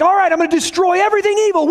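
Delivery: a quick, high acted God; God's voice. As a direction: play God rushing through the threat. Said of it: "He's like".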